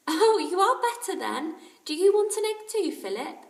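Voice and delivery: kindly mother. A narrator's reading. Aunt Ally checking on the sick boys, caring. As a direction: fuss over the sick boys.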